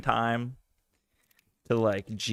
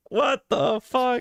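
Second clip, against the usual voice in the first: drawn-out higher what the fuck; stunned disbelief; 10 semitones higher, much slower than usual. Real, not acted. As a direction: let it hang slow and high in disbelief. Stunned reaction to clip.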